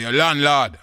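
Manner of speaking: loud, high announcement through a door; landlord calling out. Acted. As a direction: shout back who you are.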